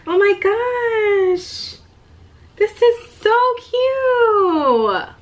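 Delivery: high, slow, drawn-out squeal of delight; overcome, gushing over a handmade gift; emotional gushing. Real, not acted. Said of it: "I got emotional looking at this".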